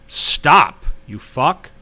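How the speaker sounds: retold command to halt, punched then eased; retold commanding stop. Telling a driver to stop for him, retold sharp command. Retells confronting driver with hand up.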